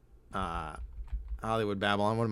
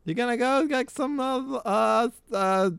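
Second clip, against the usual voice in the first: much higher, louder mock mother; lunch lady voice; 12 semitones higher, 3 dB louder than usual. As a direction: coo like a creepy doting mom. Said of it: "my lunch lady's kinda based on Adam Sandler's".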